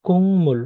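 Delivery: "কুংমুল" with ng before m, high then falling; nasalised pronunciation. Shown as a demonstration. Teacher answering with the changed sound, nasalised pronunciation. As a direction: hum the ng in "কুংমুল" and let it fall. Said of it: "এটার উচ্চারণ কি হবে".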